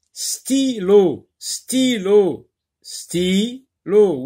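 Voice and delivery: "Stylo" high-pitched, as "sty... lo"; repeated syllable drill. Shown as a demonstration. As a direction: repeat "Stylo" in two held, separate syllables. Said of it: "Répète plusieurs fois ce mot".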